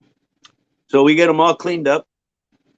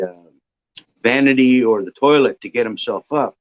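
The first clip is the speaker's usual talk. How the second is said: ordinary delivery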